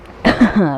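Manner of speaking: real throat-clearing cough. Real, not acted. Gamer interrupted mid-commentary, real cough. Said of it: "I got a cough".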